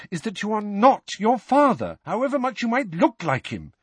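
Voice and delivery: high, insistent motherly lecture; stern, agitated reproof; emphatic scolding. A narrator's reading. Mother lectures Harry heatedly.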